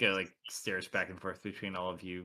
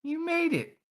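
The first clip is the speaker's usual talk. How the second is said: very high, loud hag; female hag voice; 9 dB louder than usual. Acted. Game master as the hag welcoming the party, high female voice. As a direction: greet them in a high-pitched female villain voice. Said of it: "Female voice. Very familiar".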